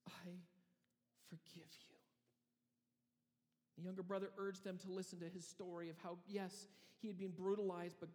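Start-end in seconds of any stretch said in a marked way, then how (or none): 0.1-2.0 breathy whispered forgiveness, very soft; tearful whisper. Acted. The younger brother forgives his brothers, a tearful whisper. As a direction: whisper it through tears.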